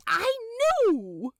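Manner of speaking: a prim angel, caught out and flustered; Aziraphale voice. A narrator's reading. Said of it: "Aziraphale flaps the scroll at Crawly again".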